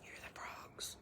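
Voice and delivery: whispered, hushed to catch the frogs; soft whisper over the dawn chorus. Real, not acted. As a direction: breathe it so the frogs keep singing. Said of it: "You can hear the toe frogs this morning".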